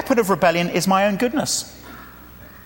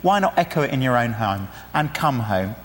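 usual speaking voice

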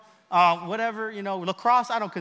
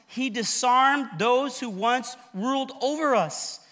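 ordinary delivery

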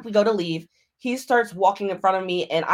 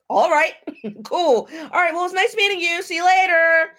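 high, singsong mock-cheery goodbye; gleeful farewell; 9 semitones higher, 4 dB louder than usual. Woman happily dismissing the date who rejected her, gleeful. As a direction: brightly send them off, getting louder at the end.

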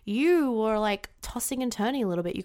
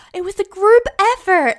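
loud, high-pitched mock deflection; mock modest protest; 10 semitones higher, 12 dB louder than usual. Woman deflecting praise for her work, loud mock modesty. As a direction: blurt it out high and loud, sending up false modesty.

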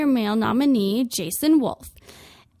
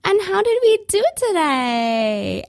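high, louder, slow chirpy saleswoman; perky clerk; 8 semitones higher, 5 dB louder than usual. Retold or imitated. Retail worker greeting customer leaving the change room, high and perky. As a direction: ham up the fake-friendly clerk. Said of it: "are like".

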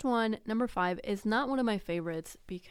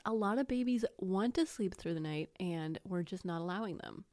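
ordinary delivery

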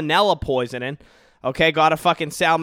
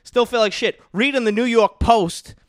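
usual speaking voice

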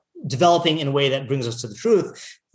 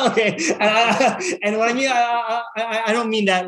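high, amused backpedal breaking out of a laugh; 7 semitones higher than usual. Real, not acted. Teacher laughs at own jab at listeners, laughing. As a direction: laugh through a hurried clarification, pitched high.

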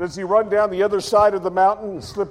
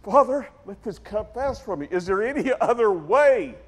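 higher, strained plea to a father; pleading prayer; 6 semitones higher than usual. Retold or imitated. Jesus praying before the cross, pleading higher. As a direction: beg for another way out. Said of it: "And he says".

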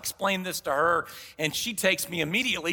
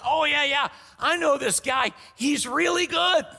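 high, eager vouching for a friend; a friend vouching for a tradesman, high and eager; enthusiastic recommendation; 6 semitones higher than usual. Retold or imitated. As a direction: gush about the guy like he's the best. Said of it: "when someone says".

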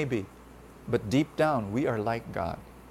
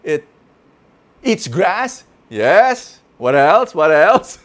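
louder, eager guessing; horse guessing; 2 semitones higher, 10 dB louder, slower than usual. A narrator's reading. Horse guesses aloud what a horse does. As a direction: list answers, pressing on. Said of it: "And the horse said".

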